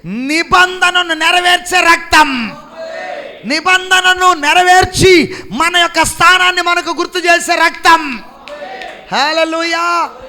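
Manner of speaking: loud, high, ringing proclamation; full-force preaching. Real, not acted. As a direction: thunder it out to the congregation, drawing out key words.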